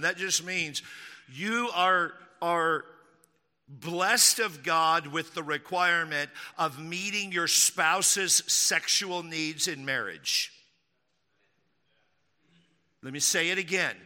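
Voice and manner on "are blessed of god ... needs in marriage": ordinary delivery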